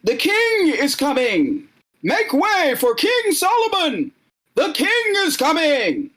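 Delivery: crier clearing the way, pitched high to carry; distant heralds yelling. Acted. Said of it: "some people yell out from a distance".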